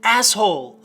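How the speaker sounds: loud, indignant exclamation; crying out. A narrator's reading. Drunk calling at the darkened doors, crying out. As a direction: call it out hard. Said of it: "I cried out".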